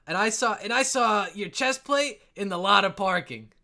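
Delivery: very loud, high chiming in; boisterous dwarf. Acted. Another guy pops up about the boot, boisterous. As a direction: shout out your sighting over the bar.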